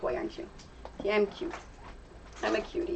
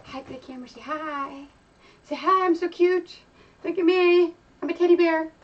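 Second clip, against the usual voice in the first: voicing the dog; 6 semitones higher than usual. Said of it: "and saying".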